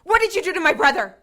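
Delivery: loud, fast, furious demand; angry demand. Acted. Sister confronting the hunter, furious shout. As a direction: shout it at him in rage.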